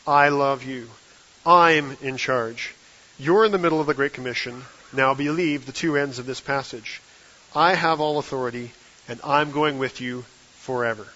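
loud, emphatic retelling, each phrase set apart; forceful. Retold or imitated. Preacher quotes Jesus speaking forcefully in his face, loud and emphatic. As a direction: press each statement home firmly and loudly. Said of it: "spoke forcefully in my face".

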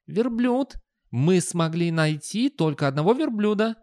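high-pitched youth voice; skinny youngster. Acted. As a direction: explain patiently in a high young voice. Youngster explains to boss.

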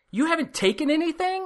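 imitated disbelief, very high rising question; incredulous nurse. Nurse learns patient took no painkillers, retold incredulity. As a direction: pitch way up as if you can't believe it.

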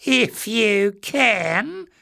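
very slow, high, loud creature threat; sly threat. A narrator's reading. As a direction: let the menace hang on each word. Greyness menacing addition.

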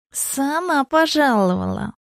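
slow, high hissing snake voice. A narrator's reading. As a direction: draw it out like a serpent. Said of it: "просвистела голова змеи".

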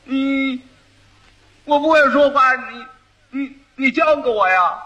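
dim-witted groom stuttering his reply; stammering fool. Acted. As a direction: get stuck on the first word, then blurt.

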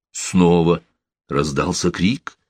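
ordinary delivery

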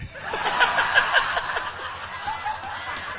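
airy high-pitched laughter; a real laugh breaking out; high breathy laugh. Real, not acted. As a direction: burst out laughing on a rising breath. Audience laughter at joke.

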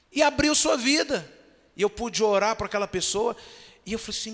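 ordinary talk, nothing marked

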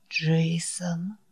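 shaky breathless whisper of a name; trembling whisper. Acted. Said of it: "прошептал он дрожащим голосом". (дрожащий шёпот)

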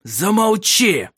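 slow, harsh otherworldly command; a cold creature silencing her; harsh order. Acted. Grim companion silences the girl, slow and harsh. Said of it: "жёстко сказал Мар".

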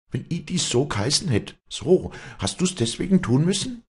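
high, agitated retort; feverish. A narrator's reading. Walli challenges Vinzenz's excuse, feverish and high. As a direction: throw it back in feverish high pitch. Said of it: "fieberte Walli".

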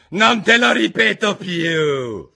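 loud, high, drawn-out royal shriek; stamping-mad queen, high and loud; furious queen's yell. A narrator's reading. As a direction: yell it slowly as an enraged queen. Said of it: "urlò la regina battendo il piede rabbiosa".

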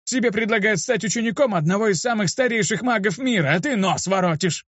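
put-on high-pitched, offended old mage; indignant scolding. A narrator's reading. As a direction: berate the pupil without really shouting. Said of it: "закричал на меня старик". (возмущённая стариковская отповедь)

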